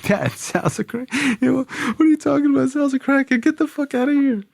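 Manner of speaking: high-pitched frantic rant, speeding up; a teen shrieking that his friend's a crackhead; outraged disbelief. Retold or imitated. Outraged at dad.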